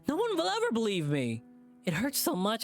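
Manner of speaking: high, breaking sob on ever; sobbing. Acted. T J breaking down in front of the group, sobbing. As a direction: cry it out as you break down. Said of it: "breaks down into a sob".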